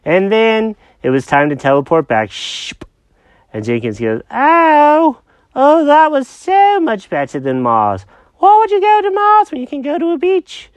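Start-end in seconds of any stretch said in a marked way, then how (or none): 4.3-10.7 high-pitched confused cat; squeaky cartoon cat, lost and puzzled. Jenkins voice.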